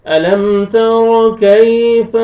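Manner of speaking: slow Quranic recitation. Shown as a demonstration. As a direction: recite the verse in a drawn-out chant. Full Quran verse recitation.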